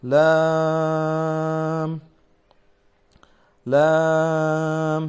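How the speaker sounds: লাম held very long; Arabic letter lam. Shown as a demonstration. Teacher demonstrating লাম for students. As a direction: stretch লাম out slowly. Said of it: "জিহ্বার আগার কিনারা".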